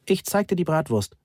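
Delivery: lightly acted call; calling after someone. A narrator's reading. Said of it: "rief ich ihm hinterher".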